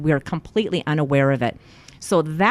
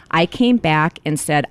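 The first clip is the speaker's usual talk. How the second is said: plain reading voice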